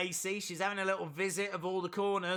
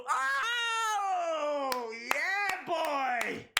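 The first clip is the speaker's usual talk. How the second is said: high, drawn-out whoop; excited cheer; 9 semitones higher, much slower than usual. Real, not acted. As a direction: cheer the big move wildly. Cheering a top-rope moonsault.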